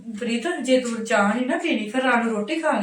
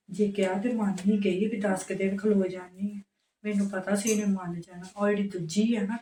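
softer, lower grumbling to herself; muttered aside; 3 semitones lower, 5 dB quieter than usual. Acted. Wife grumbles quietly to herself about Preet and the other sister. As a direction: complain under your breath once she's gone.

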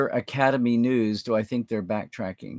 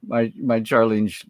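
ordinary talk, nothing marked

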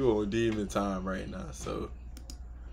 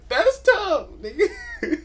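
high, loud, laughing repeat; mock quote through laughter; 4 dB louder, slower than usual. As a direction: crow it back, cracking up laughing.

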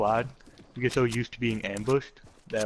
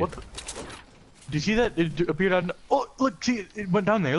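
high, slow amazed exclaim; 8 semitones higher, slower than usual. Real, not acted. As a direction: marvel at a strange glitch. Amazed at glitch.

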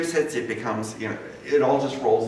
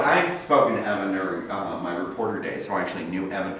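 normal talking voice

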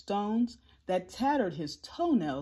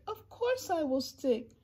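higher-pitched, earnest promise; raised, reassuring Horton voice; loyal elephant; 7 semitones higher than usual. A narrator's reading. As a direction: pledge loyalty to small friends. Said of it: "Horton answered".

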